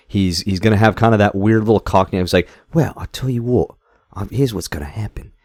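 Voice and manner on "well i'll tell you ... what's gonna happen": a hint of an Australian tough guy; Statham impression in Australian twang. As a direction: do Statham with a slipped-in Australian accent. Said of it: "Why do you sound Australian?".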